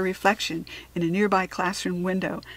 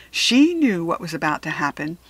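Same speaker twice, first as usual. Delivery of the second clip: ordinary delivery, nothing marked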